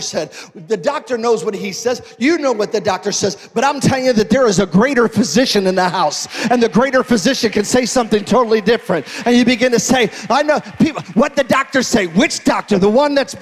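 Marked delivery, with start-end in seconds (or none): 2.2-13.4 loud, rising preacher's call; full force preaching. Acted. Pastor building to a climax about the greater physician, loud preaching. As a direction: preach it out to the back row.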